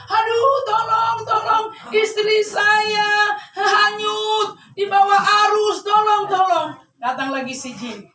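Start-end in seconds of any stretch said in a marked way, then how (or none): 0.1-6.8 high, drawn-out mock wail; wailing for help. Old man whose wife is swept away, mock tearful cries for help. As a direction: cry out in hammed-up desperation. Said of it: "dia menangis".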